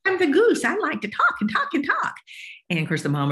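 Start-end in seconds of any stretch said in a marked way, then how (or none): 0.1-2.2 put-on high, bright-eyed kid voice; chatty child. Child telling parent she's the goose, high and eager.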